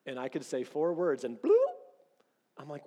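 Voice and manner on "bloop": high sharp bloop like a phone alert; phone notification bloop. Retold or imitated. Husband imitating wife's phone alert, a high bloop.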